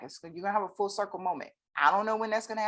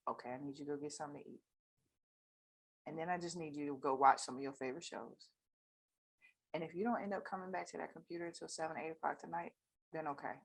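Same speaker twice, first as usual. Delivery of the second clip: much softer, gentle counseling; calm gentle advice; 3 semitones lower, 8 dB quieter than usual. Retold or imitated. Counselor to tired client, soft gentle advice. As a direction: softly guide them to rest. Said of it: "I said".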